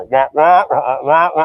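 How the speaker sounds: loud droning wah wah like a muffled trumpet; loud nonsense wah-wah teacher drone; Charlie Brown teacher. Retold or imitated. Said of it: "it's the old Charlie Brown teacher".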